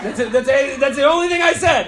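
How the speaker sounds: high, loud exasperation; exasperated protest. Real, not acted. Comic protesting to the audience that it's all he said, loud exasperation. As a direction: raise your voice in protest at how little you said.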